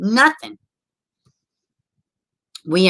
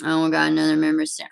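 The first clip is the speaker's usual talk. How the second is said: usual speaking voice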